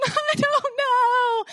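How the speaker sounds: high, drawn-out mock wail; imitated child's sobbing. Preacher likens Paul to her daughter, mock sobbing. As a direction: bawl it like a caught little kid, exaggerated. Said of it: "Like, Avery".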